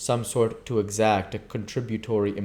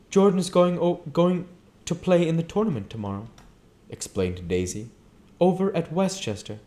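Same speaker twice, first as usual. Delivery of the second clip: lightly voiced Daisy, higher and bright; a hint of a chattering hostess; Daisy explaining; 9 semitones higher than usual. Daisy tells Nick about Jordan's tournament, lightly voiced.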